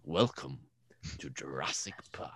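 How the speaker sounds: lightly imitated film line, dropping to a whisper; Attenborough impression. Quoting a favourite film moment to friends, Attenborough impression. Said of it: "Attenborough's like".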